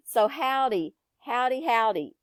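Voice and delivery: ordinary talk, nothing marked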